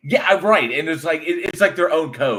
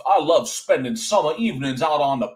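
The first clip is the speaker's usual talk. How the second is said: highfalutin Georgia accent; 4 semitones higher, 3 dB louder than usual. Shown as a demonstration. Showing a friend the Georgia accents, highfalutin Southern. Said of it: "that's that highfalutin kind of Georgia accent".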